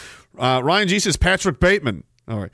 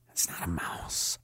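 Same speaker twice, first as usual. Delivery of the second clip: whisper; 13 semitones lower, 16 dB quieter, slower than usual. Pretend. Third repeat, whispered.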